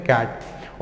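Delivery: कैट said softly with a short flat a; softly modelled English cat; model pronunciation. Shown as a demonstration. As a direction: say कैट with the a of cat, not केट. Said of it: "याद रखो इसको कैसे पढ़ते हैं".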